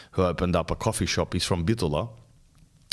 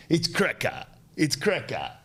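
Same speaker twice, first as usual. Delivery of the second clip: mocking the word; 7 semitones higher than usual. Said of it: "Worst word".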